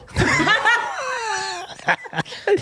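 long, high burst of laughter; high-pitched laughter. Real, not acted. Host reacting to co-host's silly word guess, high laughter.

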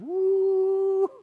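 high, sustained rising woo; a falling-away whoosh sound; comic high woo; whistling woo. Retold or imitated. Invitations vanishing.

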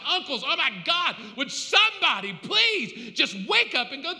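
loud, high cry from the dead man; impassioned, shouted plea; desperate pleading. Acted. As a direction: beg the living at full voice, acting his torment. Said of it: "And he's like".

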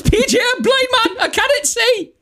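very high, wailing comic impression; hammed-up high-pitched regional wail; Ant and Dec. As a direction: cry out in mock agony. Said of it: "do that voice and pretend to be Ant and Dec".

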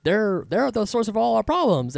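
high-pitched mimicked complaint; mock blaming. Rich dudes blaming war refugees, mock accusing.

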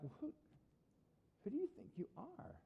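soft, high-pitched affront; defensive Pharisee. Acted. Pharisees answer Jesus's accusation, defensive and affronted. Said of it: "imagine the Pharisees being like".

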